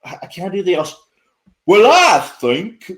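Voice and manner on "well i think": put-on higher, louder stab at an Australian accent, broken off; failed Australian accent. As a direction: try an Aussie accent and give up mid-phrase. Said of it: "I can't do the Australian accent".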